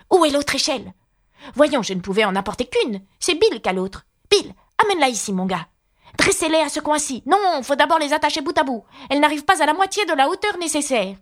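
high, busy character voices calling out orders; animaux affairés. A narrator's reading. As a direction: pitch it up, like workers directing each other. (voix aiguës d'animaux qui s'affairent)

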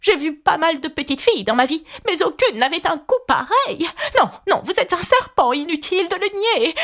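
high-pitched, disbelieving bird; scornful pigeon. A narrator's reading. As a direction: argue high, dismissing every excuse. Scornful pigeon dismisses Alice's claim.

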